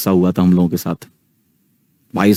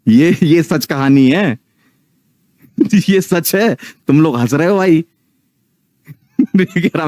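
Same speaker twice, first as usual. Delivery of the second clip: real laughter, slow and higher, insisting it's true; laughing; 7 semitones higher than usual. Real, not acted. Telling viewers it's a true story, laughing. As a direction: laugh through the words while swearing it really happened.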